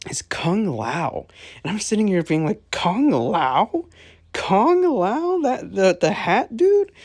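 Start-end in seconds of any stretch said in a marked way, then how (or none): 2.7-6.9 high-pitched, baffled disbelief; incredulous. Retold or imitated. Viewer reacting to the hat dude being the chosen fighter, incredulous. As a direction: question it as if you can't believe it, voice leaping up. Acts out own disbelief at reveal.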